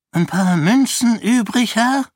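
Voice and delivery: high, thin pleading beggar voice. A narrator's reading. Old beggar pleading with the lord for coins. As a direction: beg for coins from a passing lord. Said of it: "flehte eine Stimme".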